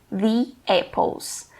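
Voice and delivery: slow "the apples", "the" as "thee"; THE before a vowel. Shown as a demonstration. English teacher repeating "the apples" for learners, slow "thee". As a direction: draw out "the apples" with "the" rhyming with "see". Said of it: "vai ser pronunciado como".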